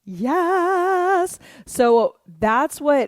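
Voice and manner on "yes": bright yes. Real, not acted. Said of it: "cheerful high praise to class".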